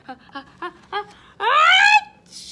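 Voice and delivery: building pretend sneeze; big sneeze. A narrator's reading. Pepper tickles the sleeping bear, big acted sneeze. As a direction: huff in bursts, then a long achoo. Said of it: "he sneezes".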